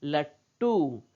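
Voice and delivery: लट्टू as 'lat-too', drawn out with a held ट; half consonant. Shown as a demonstration. Teacher modelling the word for children, लट्टू as 'lat-too'. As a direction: stop on the half ट, then release a long टू. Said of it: "कैसे बोल रहे हैं?".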